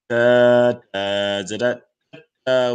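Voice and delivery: ordinary talk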